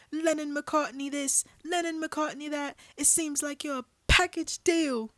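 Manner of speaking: an outraged British rant at Paul and John; furious British accent. Acted. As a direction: tear into both men in a British accent. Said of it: "British accent".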